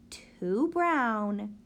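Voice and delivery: ordinary delivery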